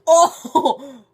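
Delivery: high, slow, loud laughing; crying with laughter. Real, not acted. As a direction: squeal out words while laughing helplessly. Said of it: "I'm actually crying".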